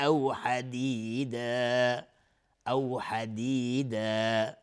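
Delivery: slow chanted "حدیدا" with long final aa; madd iwad. Shown as a demonstration. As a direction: draw out the last vowel of "حدیدا". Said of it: "حدیدا مد عوض". (مد عوض، کشیدن الف آخر «حدیدا»)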